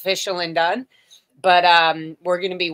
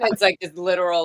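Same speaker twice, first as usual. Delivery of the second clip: ordinary talk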